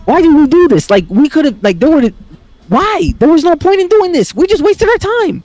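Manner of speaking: very high-pitched mock complaint; exasperated whine. Kids to mom after useless meeting, exasperated whine. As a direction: wail it in high, hammed-up frustration.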